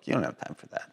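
breathy whispered aside. Real, not acted. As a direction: drop to a secret whisper. Aside, dropped voice.

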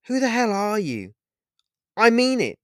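high, heartbroken threat with murderous rage. Acted. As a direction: threaten him through heartbreak. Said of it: "heartbroken tone of voice, tinged with an almost murderous rage".

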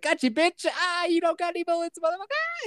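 high-pitched acted jeer; hammed-up taunt, rising high; mock gloating villain. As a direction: crow over a foiled enemy in a high voice. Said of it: "And he's like".

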